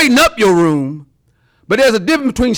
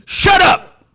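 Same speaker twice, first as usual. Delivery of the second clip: higher, sharp rebuke; stern command; 9 semitones higher than usual. Acted. Jesus rebuking the demon, a sharp high command. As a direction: snap it like a command. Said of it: "In our English language, it would be".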